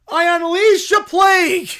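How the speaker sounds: loud mock-grand curse; grand proclamation. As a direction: cast the plague like a prophet. Bear casts a plague.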